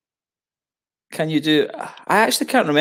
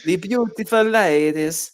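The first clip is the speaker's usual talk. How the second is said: put-on slow comic Italian accent, raised pitch; 4 semitones higher, slower than usual. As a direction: draw it out like a hammed-up Italian. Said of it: "the Italian guy".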